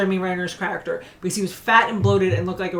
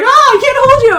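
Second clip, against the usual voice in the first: mock desperate cry; 13 dB louder than usual. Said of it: "he should have been like".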